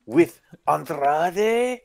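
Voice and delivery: slow mock Spanish accent, pitch jumping up on Idolo; mocking a wrestler's Spanish accent, slow. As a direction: stretch it out in a hammed-up Spanish accent. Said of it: "comedic fucking version of a Spanish, accent".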